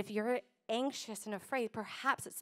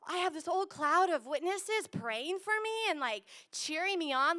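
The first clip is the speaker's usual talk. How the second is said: amazed awe; 6 semitones higher than usual. Pretend. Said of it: "But to be like".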